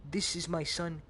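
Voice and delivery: normal talking voice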